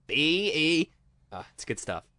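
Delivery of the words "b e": "B E" as long separate letters, higher; mimicked barman slowly spelling "B E", raised pitch; retold spelling out. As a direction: echo the spelling, stretching "B E". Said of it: "And he goes".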